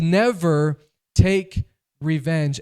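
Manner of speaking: slow, loud solemn command; solemn decree. Shown as a demonstration. Preacher voicing the Lord's command to the church, slow and loud. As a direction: lay it down slowly like scripture. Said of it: "The Lord says".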